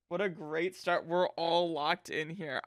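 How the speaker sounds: ordinary talk, nothing marked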